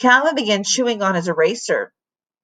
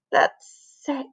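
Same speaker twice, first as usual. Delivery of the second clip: gagging; 6 semitones higher, 3 dB quieter than usual. A narrator's reading. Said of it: "she gagged".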